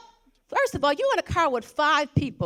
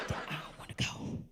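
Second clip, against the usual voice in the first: hushed unvoiced whisper; breathless secretive whisper; 15 dB quieter than usual. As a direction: whisper it like you don't want the others to hear. Reluctant friend quoted in car.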